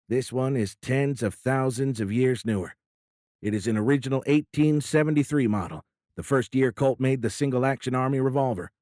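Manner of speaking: put-on an old politician owning up with a grin; folksy president. President admitting his hidden pistol to the admiral, folksy and loud.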